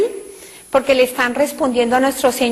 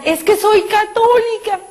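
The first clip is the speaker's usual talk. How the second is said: high, slow retold cry; imitating a desperate shout; 8 semitones higher than usual. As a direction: call out in despair, slowly. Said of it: "empecé a gritar".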